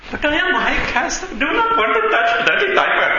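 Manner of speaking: husband refusing diapers, in a high mock Indian accent.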